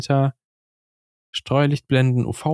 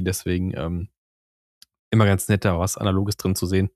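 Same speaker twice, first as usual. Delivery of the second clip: ordinary talk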